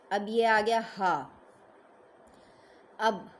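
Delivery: ordinary talk, nothing marked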